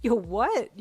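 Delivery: loud, high, thrilled disbelief; excited gasp. A narrator's reading. Reacting to a friend's baby news, gasping excitedly. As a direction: react loudly, astonished and delighted. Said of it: "you gasped excitedly".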